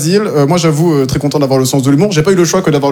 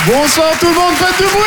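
rousing stage shout; 12 semitones higher than usual. Real, not acted. Comic greeting the club crowd, high rousing call. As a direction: whip the audience up.

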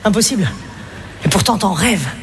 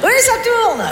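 much higher, sing-song encouragement; fake-cheery mum; 11 semitones higher than usual. A bored mum humouring her child on the roundabout, fake cheer.

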